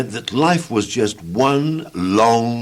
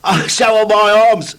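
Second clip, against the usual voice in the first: high, louder cheerful voice; cheerful Mister Tickle; 12 semitones higher, 6 dB louder than usual. Acted. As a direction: brag happily about those long arms. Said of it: "said Mister Tickle cheerfully".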